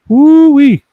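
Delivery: high, loud Cajun whoop; Cajun woo-wee. Retold or imitated. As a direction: whoop it like a swamp hunter. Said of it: "The trick to Toy Landry".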